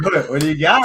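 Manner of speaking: egging them on through laughter; eager drunk. Retold or imitated. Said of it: "I'm like".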